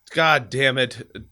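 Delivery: slow, loud pained curse. Real, not acted. Man curses slowly through back pain.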